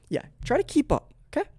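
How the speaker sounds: high, put-on chiding; mock condescension. Comedian chiding viewers who can't follow, high mock condescension.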